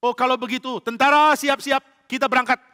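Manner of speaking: rally the army; excited royal command; commanding king. Acted. King orders troops out.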